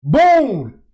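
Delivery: ordinary talk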